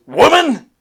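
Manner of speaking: high, loud barked word; loud high Vegeta call. Retold or imitated. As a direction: bark it like an arrogant prince. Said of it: "a regular talking one".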